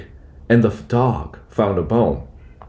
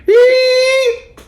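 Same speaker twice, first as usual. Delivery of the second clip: piercing high bird screech; bird's shriek; 5 dB louder than usual. A narrator's reading. Green bird bursts from the tree, ear-splitting high shriek. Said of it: "with an ear-splitting shriek".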